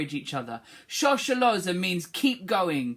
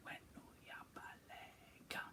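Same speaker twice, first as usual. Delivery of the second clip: whispered Wenuya baleka; whispered echo; 30 dB quieter than usual. Shown as a demonstration. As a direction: whisper it back. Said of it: "pupil echo".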